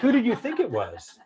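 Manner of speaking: high-pitched amused question, imitated; Oprah Winfrey. Star teasing the author about the call, imitated. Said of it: "Oprah said".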